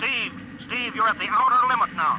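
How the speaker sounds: desperate radio warning to a climbing pilot; urgent warning. Acted. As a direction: warn him hard before it's too late.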